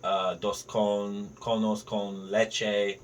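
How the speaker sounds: slow careful Spanish order of ice cream. Shown as a demonstration. Tourist ordering ice cream in Italy, slow careful Spanish. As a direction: sound out the Spanish slowly like a tourist. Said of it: "I spoke a little bit of Spanish".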